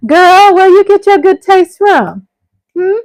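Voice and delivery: put-on high, loud sassy girl talk; sassy girlfriend voice. Teasing a viewer about her good taste, sassy and high. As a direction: play the nosy girlfriend, teasing.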